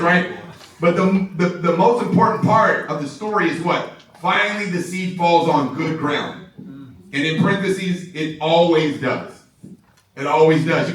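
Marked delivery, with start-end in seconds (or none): none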